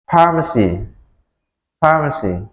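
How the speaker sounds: teacher models the word Pharmacy for repeating; model pronunciation. Shown as a demonstration. Teacher to students, modelling Pharmacy clearly. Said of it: "Oke, perhatikan".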